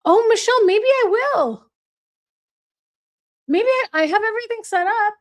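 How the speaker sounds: high, eager hello to a friend; light up as she arrives; excited greeting. Real, not acted.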